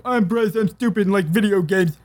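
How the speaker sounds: high, dopey mock voice; mocking impression of Bryce. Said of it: "I could go".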